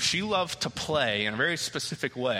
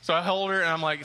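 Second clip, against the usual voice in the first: ordinary talk, nothing marked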